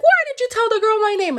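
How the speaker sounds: very high, loud mock shriek; hammed-up screeched demand; furious confrontation. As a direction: shriek it as the enraged old lady. Livid lady confronts the crab again.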